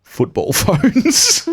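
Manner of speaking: giggling through the words; laughing. Real, not acted. Laughing at dated prop.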